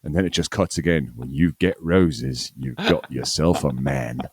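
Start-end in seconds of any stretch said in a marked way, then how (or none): 1.2-4.2 slow, drawn-out Australian tagline; lightly voiced Australian announcer; mock Australian tagline. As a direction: intone it like a vintage Australian ad. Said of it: "they're all talking Australian".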